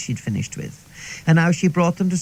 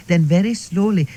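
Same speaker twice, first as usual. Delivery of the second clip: ordinary delivery, nothing marked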